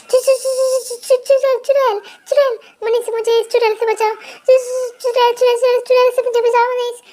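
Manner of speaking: high, fast, panicked stammering; terrified stammer. Acted. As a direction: scream for help in terror. Rama screams for husband.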